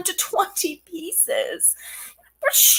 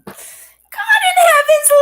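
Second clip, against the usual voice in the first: high, loud, slow exclaim; outraged cry; 14 semitones higher, 6 dB louder, slower than usual. Acted. Nurse exclaims at impatient Juliet.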